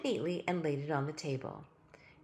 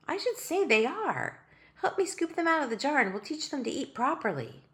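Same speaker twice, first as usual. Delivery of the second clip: put-on girl's voice; 8 semitones higher than usual. Ellie's voice held.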